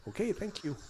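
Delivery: high, soft, meek thanks; baffled politeness. Retold or imitated. Driver to incomprehensible Scottish cop, soft clueless thanks. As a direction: thank someone while understanding nothing. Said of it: "of course I can't under".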